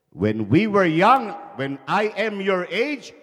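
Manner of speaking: father scolding his son about movies; lecturing father. Acted. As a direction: moralise like a stern dad.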